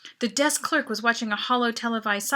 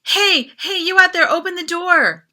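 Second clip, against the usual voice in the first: higher, loud shout for help; yelling; 8 semitones higher, 11 dB louder than usual. A narrator's reading. Locked-in boy shouting through the door for help, loud and high.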